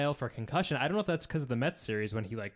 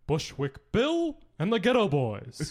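put-on slow, loud, higher old-politician rasp as Bernie Sanders; Bernie Sanders impression; 7 semitones higher, 5 dB louder, slower than usual. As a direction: channel Bernie Sanders naming rap groups slowly. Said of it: "this is my impression of Bernie Sanders".

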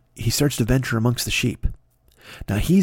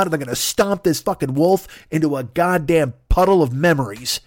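usual speaking voice

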